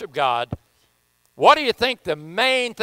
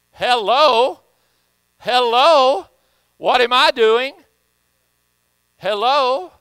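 calling out; 5 semitones higher, 7 dB louder, slower than usual. Real, not acted. Preacher calling out to congregation.

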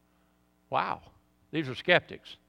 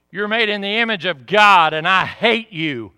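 loud, raised-voice venom; hateful demons; 6 semitones higher, 11 dB louder than usual. Retold or imitated. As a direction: imitate demons raging at the damned. Demons voicing hatred in hell.

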